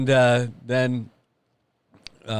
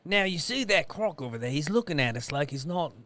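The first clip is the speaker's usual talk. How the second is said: put-on softer, higher Australian accent drifting British; Australian accent slipping British; 3 semitones higher, 6 dB quieter than usual. Wildlife guide watching a croc, wobbly Australian accent. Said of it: "that was more like a British thing".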